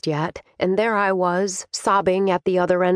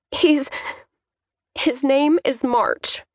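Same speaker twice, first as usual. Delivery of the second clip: high, halting confession; slow, reluctant admission to dad; hesitate, then name him in a higher voice; hesitant daughter; 7 semitones higher, slower than usual. A narrator's reading. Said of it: "I gulped".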